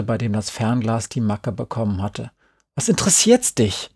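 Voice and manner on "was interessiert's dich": sharp, much higher snap; annoyed. Acted. Boy snaps at a prying friend, annoyed. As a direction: bite back, fed up. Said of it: "Ich war genervt".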